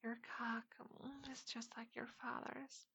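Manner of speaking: hushed breathy confession; whispering. Acted.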